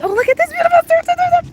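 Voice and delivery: high, loud, rushed delight; excited outburst. Real, not acted. Streamer showing viewers the beautiful surf, excited. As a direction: gush fast and high at the sight.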